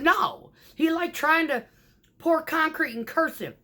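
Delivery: high, loud comic punchline; excited punchline. Retold or imitated. Driver topping her joke to laughing office, high and loud.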